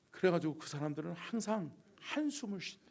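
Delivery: ordinary talk, nothing marked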